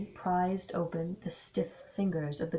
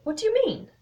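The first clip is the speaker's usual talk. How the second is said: high, louder startled question; pitched-up alarmed query; 8 dB louder, faster than usual. Acted. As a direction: demand an explanation, taken aback. Colin startled by Poirot.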